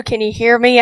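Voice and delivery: ordinary talk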